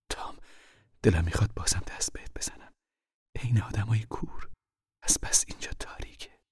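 urgent whisper in the dark; hushed whisper. Acted. Mother whispering warnings to her hiding son. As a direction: keep it breathy and secret.

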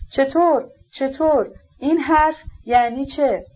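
high, disbelieving questions broken apart; mildly acted horror, words set apart; shocked, disjointed speech. A narrator's reading. Mother cannot believe the prince's words, high-pitched shocked questioning. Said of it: "با حیرت و نفرت از وحشت به لکنت افتاده".

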